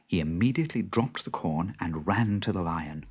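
usual speaking voice

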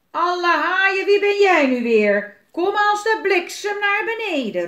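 furious high bellow as a pirate; angry shout. A narrator's reading. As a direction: roar it out, loud and high. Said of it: "roept Willem kwaad". (woedend geschreeuw)

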